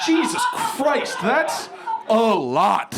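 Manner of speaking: high, slow exclamation of shock; taken aback. Retold or imitated. Comedian reacts to his friend's extreme disgust, shocked. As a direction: exclaim it in drawn-out disbelief.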